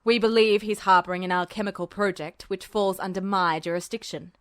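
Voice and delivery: lightly gruff official; low, gruff military man; a hint of a gruff general. A narrator's reading. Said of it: "Tanaka said gruffly".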